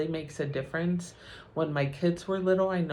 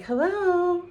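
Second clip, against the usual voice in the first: much higher, sugary polite hello; sweet phone voice; 13 semitones higher, 5 dB louder than usual. Retold or imitated. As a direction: switch instantly to a sweet, polite phone greeting. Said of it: "That tone of voice could just change on a dime".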